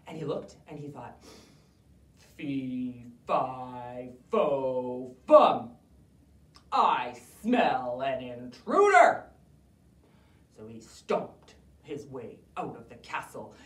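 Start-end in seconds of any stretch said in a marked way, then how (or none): 2.4-9.3 low, building to a loud slow roar; a grumpy giant sniffing out intruders; giant's chant, low and loud; booming giant. Acted.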